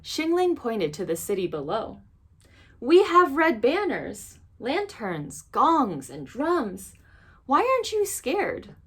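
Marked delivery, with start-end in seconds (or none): 2.8-8.8 put-on girl confronting the beast, baffled; indignant girl. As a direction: demand to know why he isn't scared. Girl challenges the monster.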